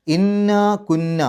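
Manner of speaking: ഇന്ന കുന്ന with the doubled ന hummed, high and slow; nasal ghunna. Shown as a demonstration. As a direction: hum the nun in ഇന്ന കുന്ന. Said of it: "നൂനിൻ്റെ ശബ്ദം മണിക്കുക".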